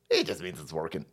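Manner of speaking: muttered-down repeat; low, soft mock echo. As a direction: drop it low and soft like a mumbled excuse. Mocking the excuse.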